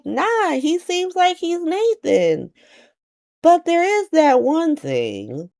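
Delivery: slow, very high mock reply; high, hedging. Felicia reassuring Anna about Nathan, high and hedging. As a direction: draw it out high, hinting at a catch.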